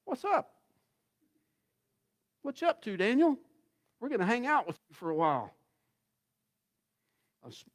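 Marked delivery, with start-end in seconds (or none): 0.0-5.5 high, fast, casual buddy voice; friendly lions. Retold or imitated. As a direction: play the lions as chummy pals. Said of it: "they look at him like".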